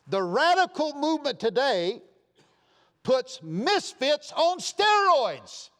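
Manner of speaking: loud, high, slowed preaching build; fiery preaching. Acted. Preacher rousing the congregation, loud and high. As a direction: thunder it from the pulpit.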